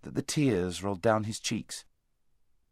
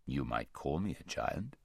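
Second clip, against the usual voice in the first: large deep voice; 9 semitones lower, 10 dB quieter than usual. A narrator's reading. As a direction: speak low and vast, unhurried. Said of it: "said the large voice".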